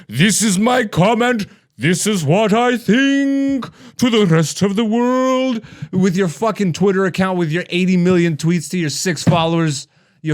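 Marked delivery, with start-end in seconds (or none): 0.1-5.6 slow, self-important mock voice; mocking pompous commenter. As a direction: ham up a smug know-it-all.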